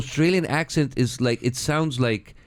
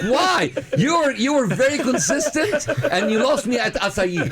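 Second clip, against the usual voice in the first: exasperated; 9 semitones higher than usual. Retold or imitated. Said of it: "It's like".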